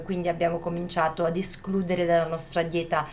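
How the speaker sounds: ordinary talk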